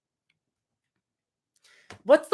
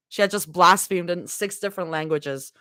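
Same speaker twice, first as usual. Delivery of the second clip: low, steady read-aloud of a fanfic chapter; story narration; 5 semitones lower than usual. A narrator's reading. Streamer reading a fanfic chapter to chat, low and steady. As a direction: read it like a cozy audiobook.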